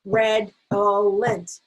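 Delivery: redolent slowly split into red-ol-ent; very slow, three separate beats; tapped-out syllables. Shown as a demonstration. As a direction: tap out redolent with "ol" in the middle. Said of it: "could be an ul or an ill".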